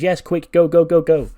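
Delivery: high, loud hurrying command; urgent shout. Acted. Alexa rushing Ivy out of the room, urgent shout. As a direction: hustle them out with rapid loud urging.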